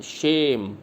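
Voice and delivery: शेम with a long falling "e"; pronunciation demo. Shown as a demonstration. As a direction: draw out the vowel in शेम. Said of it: "इसको pronounce करना है".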